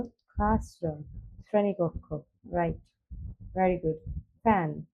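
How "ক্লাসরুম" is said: slow, clear ক্লাসরুম with aspirated k; repeat-after-me model. Shown as a demonstration. Teacher models ক্লাসরুম for pupils to repeat, slowly. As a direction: say ক্লাসরুম slowly for the class to echo. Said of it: "আমার সাথে বলো".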